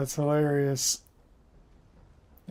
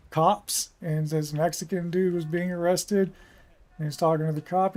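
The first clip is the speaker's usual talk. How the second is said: usual speaking voice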